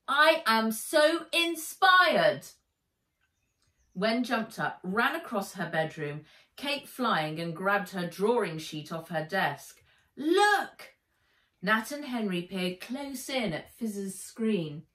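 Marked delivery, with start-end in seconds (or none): 0.1-2.5 slow, loud and high gushing. Acted. Girl gushing about Atlantis. 10.2-11.0 high, loud eager cry; excited call. Acted. Wen shows her drawing.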